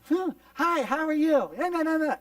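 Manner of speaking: high, loud put-on friendliness; fake cheery greeting. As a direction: greet classmates as if nothing hurts. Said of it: "And we pretend".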